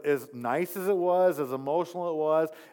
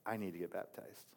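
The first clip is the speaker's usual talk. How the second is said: much softer and lower, close confession; leaning in, hushed; 7 semitones lower, 13 dB quieter than usual. Retold or imitated. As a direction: lean in and say it quietly. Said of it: "I lean forward and I go".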